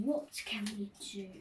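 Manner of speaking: whispered to self; hushed pondering whisper. Real, not acted. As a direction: whisper the question while thinking. Thinking aloud for a new game.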